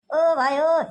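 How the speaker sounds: loud, high-pitched hail; calling out. Acted. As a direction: shout to get someone's attention. Calling out to a villager.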